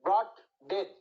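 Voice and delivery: Broad, a pause, then ডেথ, both weighty; stress on both words. Shown as a demonstration. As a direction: land broad and ডেথ as two separate hammer blows. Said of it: "দুটাই মনে হচ্ছে মাথায় হাতুড়ি মারছে".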